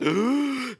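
a sharp gasp for air mid-greeting; breathless gasp. Real, not acted. Streamer greeting fans, out of breath: a gasp for air. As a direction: gulp in air, winded. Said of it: "it's because I'm out of breath".

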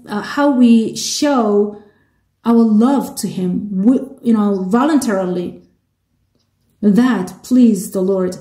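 ordinary talk

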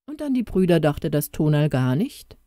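plain reading voice

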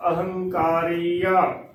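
ਅਹੰਕਾਰੀਆ drawn out syllable by syllable; slow correct pronunciation. Shown as a demonstration. Teacher models the word slowly for learners. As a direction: sound out a-hang-kaa-ria slowly. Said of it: "ਸੋ ਇਸ ਦਾ ਉਚਾਰਨ ਕਰਨਾ ਹੈ".

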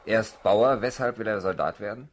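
loud commanding questions; officer's loud drill voice; barking officer. Acted. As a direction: bark at the farm boy. Officer barks at recruit.

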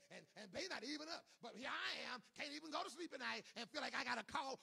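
aggrieved member, rising from talk to loud protest; complaining churchgoer building to a shout. Acted.

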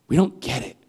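ordinary talk

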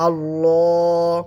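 আল্লাহ with the lips rounded and a heavy drawn lam; slow heavy recitation of আল্লাহ; heavy Allah. Shown as a demonstration. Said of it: "আওয়াজটা একটু ভারী করে পড়ব".

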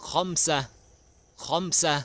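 คอมซะ high and loud, in two clear syllables; model pronunciation. Shown as a demonstration. Teacher shows children Arabic five, คอมซะ pronounced high and clearly. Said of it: "ออกเสียงว่า".

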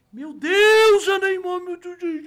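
high, loud disbelieving joy; starstruck shriek. Retold or imitated. Shop owner seeing Neymar's video, loud and high. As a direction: squeal like a fan who just saw his idol.

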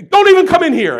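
loud, high exasperated shout from the restaurant owner; retold yell. Restaurant owner turning him away, loud high shout. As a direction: bark it out like a swamped cook.

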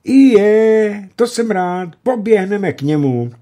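put-on high, loud boyish cry; boy shouting for joy at the news; joyful shout. A narrator's reading. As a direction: shout it with pure glee. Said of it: "vykřikl Jan".

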